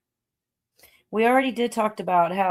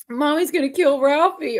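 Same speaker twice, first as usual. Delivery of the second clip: put-on kid's line; 9 semitones higher, 3 dB louder than usual. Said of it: "whenever he's like".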